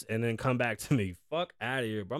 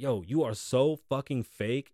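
ordinary delivery

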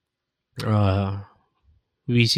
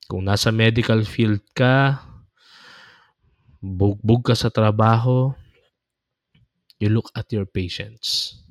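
normal talking voice

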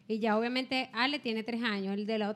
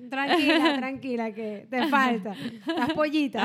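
burla entre risas; 5 dB louder, slower than usual. Retold or imitated. Mocking friend with baby.

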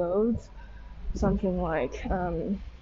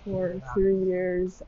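ordinary delivery

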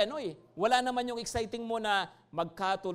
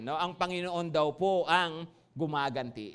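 ordinary talk, nothing marked

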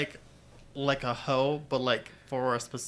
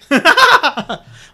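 sudden shriek of laughter; loud high laugh; 19 dB louder than usual. Real, not acted. Cohost laughing that she owns two copies. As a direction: burst out laughing.

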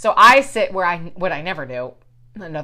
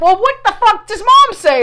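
much higher and louder exasperated demand; furious; 12 semitones higher, 8 dB louder than usual. Retold or imitated. Asking her sister what Mom said, loud and exasperated. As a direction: demand an answer, fed up.

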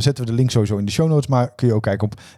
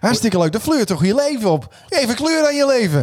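high, gushing garden-show voice; hammed-up enthusiastic gardener; Rob; 9 semitones higher than usual. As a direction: pitch climbing into camp delight. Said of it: "met Rob".

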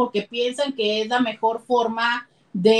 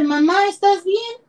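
put-on child's worried question; 7 semitones higher than usual. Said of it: "el niño te dice".

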